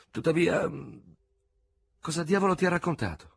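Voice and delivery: lieutenant cutting in, aghast; terrified amazement. A narrator's reading. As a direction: blurt it out in frightened astonishment. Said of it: "con una specie di stupore atterrito".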